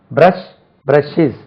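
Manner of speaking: usual speaking voice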